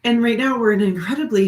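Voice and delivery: ordinary talk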